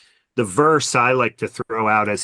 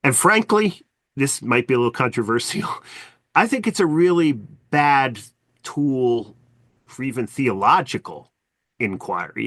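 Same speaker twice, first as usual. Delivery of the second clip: normal talking voice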